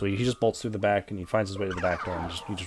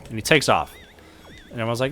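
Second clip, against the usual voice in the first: ordinary delivery, nothing marked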